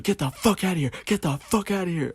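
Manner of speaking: retelling the woman ordering the worker out, high and sharp; mock yell. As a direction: imitate her throwing him out. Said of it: "starts yelling at this dude".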